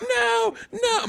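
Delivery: high squeaky panicked no; high-pitched kid pleading. Retold or imitated. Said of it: "I'm like".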